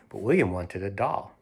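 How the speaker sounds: plain reading voice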